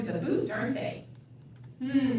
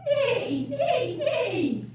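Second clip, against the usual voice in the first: high whinnying neigh; horse neigh; 11 semitones higher, 4 dB louder, much slower than usual. Acted. Reading the farm book, neighing like horses.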